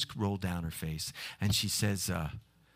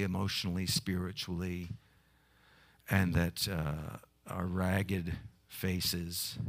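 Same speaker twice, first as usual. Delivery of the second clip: hushed reverent prayer; slower than usual. Acted. Closing prayer.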